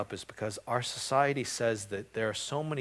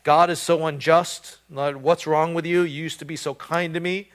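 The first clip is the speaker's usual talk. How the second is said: mock complaint; 6 semitones higher, 13 dB louder than usual. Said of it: "not saying".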